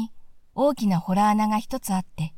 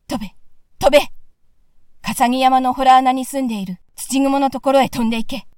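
loud, rising order to fly; shouted command; 3 semitones higher, 6 dB louder than usual. Acted. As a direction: urge the dog on at full voice. Hero commands flying dog. (黒犬に飛べと大声で命じる)

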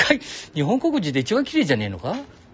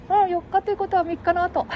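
much higher teasing put-on voice; falsetto second voice; 4 dB louder than usual. Companion finishing his sentence, high teasing falsetto. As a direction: echo the line back in a high silly falsetto.

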